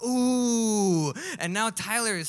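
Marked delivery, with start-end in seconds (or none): 0.0-1.1 crowd ooh. Retold or imitated. Crowd reacts again.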